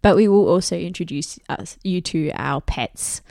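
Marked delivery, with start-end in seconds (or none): none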